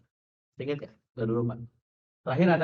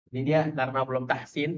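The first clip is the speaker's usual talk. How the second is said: ordinary delivery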